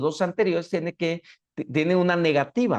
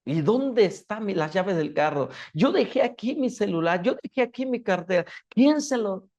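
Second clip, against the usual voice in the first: higher-pitched, agitated victim of theft; alarmed owner; 5 semitones higher than usual. Acted. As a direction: act out someone missing their belongings. Acts out owner missing their things. (dueño alarmado que busca sus cosas)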